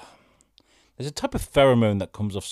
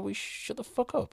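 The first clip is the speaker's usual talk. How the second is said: telling off; 14 semitones higher, 6 dB quieter, slower than usual. Retold or imitated. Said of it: "turned around and said".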